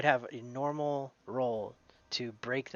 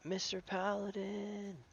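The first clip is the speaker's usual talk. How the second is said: put-on high, slow child's call for help; child crying out; 11 semitones higher, much slower than usual. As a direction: call out like a scared little kid. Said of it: "and he cries out".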